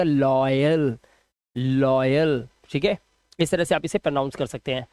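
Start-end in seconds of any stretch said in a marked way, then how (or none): 0.0-1.0 लॉयल said "LOY-ul", low and drawn out; demonstrated pronunciation. Shown as a demonstration. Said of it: "आपको बोलना पड़ेगा". 1.6-2.5 लॉयल repeated low, as "LOY-ul"; demonstrated pronunciation. Shown as a demonstration. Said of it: "आपको बोलना पड़ेगा".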